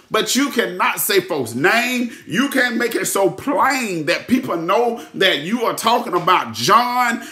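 normal talking voice

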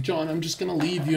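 mock her breezy breakup line; girlfriend threatening to leave.

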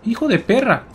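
startled curse. Real, not acted. Startled by enemy.

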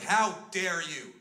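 hammed-up outrage; mock indignation. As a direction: act offended, drawing it out. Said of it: "I got in the bouncer's face".

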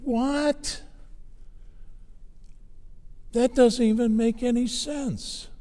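high-pitched, incredulous retort; baffled disbelief. Retold or imitated. Preacher recalling his reaction to the screen, baffled disbelief. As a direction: react as if it makes no sense at all.

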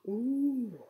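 gasp, then a drawn-out awed ooh; storybook amazement; gasping wonder. A narrator's reading. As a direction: breathe in sharply, marvel. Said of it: "gasping at all the spinning".

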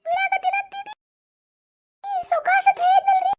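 shrill-high panicked calling out; panicked high cry. Acted. Woman stuck up the tree, crying out in high panic.

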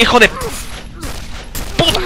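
long high shriek of fright; startled scream. Real, not acted. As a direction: scream it out, caught off guard. Susto en el juego. (grito agudo de susto)

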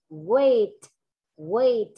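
WAIT with a popped, released T; clear final sound. Shown as a demonstration. Teacher shows class clear endings, WAIT with audible final T. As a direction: pop the T at the end of WAIT. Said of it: "có âm đuôi là rõ ràng luôn".

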